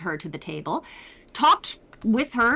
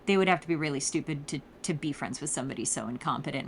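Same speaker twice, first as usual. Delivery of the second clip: cutting dismissal; 7 semitones lower, 5 dB quieter, faster than usual. Retold or imitated. Nerys insulting the strangers.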